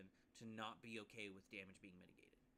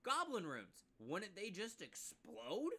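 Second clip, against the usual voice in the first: much higher, louder, slowed mock wonder; wondering player; 8 semitones higher, 8 dB louder, slower than usual. Player pitching goblin runes midstream, loud high mock wonder. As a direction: ham up a player's wild idea. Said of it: "to be like".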